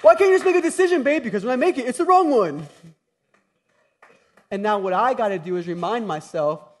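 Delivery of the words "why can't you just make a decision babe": high, loud, mock exasperated wife; wife's loud fed-up question, played for laughs; imitating wife. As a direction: fire it at him like an impatient wife. Voicing wife's complaint.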